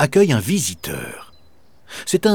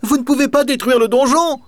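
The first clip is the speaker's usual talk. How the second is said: put-on high, louder child's protest; boy shouting from the window, voiced high; boy crying out; 14 semitones higher, 4 dB louder, faster than usual. A narrator's reading. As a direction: call down from the window to stop him. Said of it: "Octave s'écrie".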